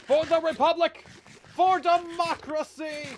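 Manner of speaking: rousing shouted rallying cry; battle cry. Pretend. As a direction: roar it like leading a charge. Mock rebellion rallying cry.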